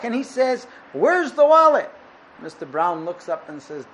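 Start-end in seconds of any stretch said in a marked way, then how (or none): 0.9-1.9 loud, high, furious demand for the wallet; angry demand. Acted. Mister Blue storming back to the spring, loud angry demand. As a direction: bark it out like a man who's been robbed.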